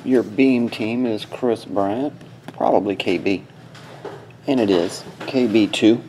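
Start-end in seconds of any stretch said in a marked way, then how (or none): none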